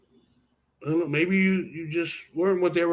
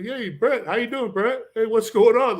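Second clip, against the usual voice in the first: higher, overly friendly hello; mock-buddy tone to a famous caller; chummy greeting; 8 semitones higher than usual. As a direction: greet him like an old pal. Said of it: "Like, we were old pals".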